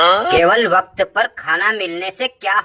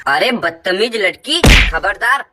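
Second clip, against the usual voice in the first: furious, louder, bark the warning; angry scolding shout; 2 semitones lower, 7 dB louder than usual. Acted. Mother-in-law yelling at insolent daughter-in-law, furious shout. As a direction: snap at the rude girl with rising fury.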